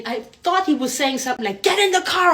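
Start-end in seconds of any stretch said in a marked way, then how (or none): 1.6-2.3 high-pitched yelled command; mock screamed order. Man in car screaming at her on the street, mock screamed order. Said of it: "screaming at me".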